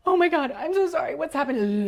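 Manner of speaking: high, loud, flustered apology; mock panic. Woman re-enacts her human self apologising mid-song, mock panicked cry. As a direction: blurt it out in comic alarm.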